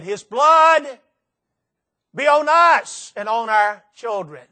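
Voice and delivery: the people crying out to Pilate, loud and slow; crowd cry. Acted. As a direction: call it out like the mob. Crowd cries in Scripture.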